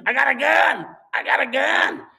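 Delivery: loud, high imitated threat; mock screaming. Old man to the couple, mock screaming. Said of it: "he screams".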